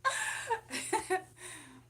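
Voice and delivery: high-pitched burst of laughter; real high laughing fit. Real, not acted. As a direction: laugh out high at your own answer. Laughs after describing her type.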